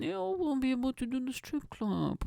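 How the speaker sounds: high, pouty whine; hammed-up disappointed little boy; mock sad. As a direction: sulk in a put-on whimper. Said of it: "I'm sad boy now".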